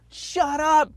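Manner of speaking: half-laughing shut her up; retold yell. Buyer telling the loan officer to stop, high mock shout. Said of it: "And I was like".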